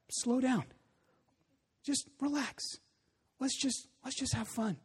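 soft, high, imitated child's reassurance; hushed retold soothing, with pauses; gentle calming. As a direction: coax your dad softly to relax. Son gently calming frustrated dad.

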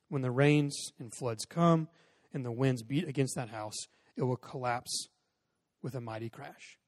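slow, measured Bible reading; scripture reading. Acted. Pastor reading Matthew to the congregation, slow and measured. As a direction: read the parable with weight.

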